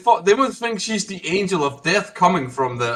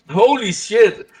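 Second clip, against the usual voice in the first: drawn-out, higher-pitched exclamation; amused shock; 7 semitones higher, 5 dB louder, slower than usual. Real, not acted. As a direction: burst out laughing in real shock. Amused shock at patient's death.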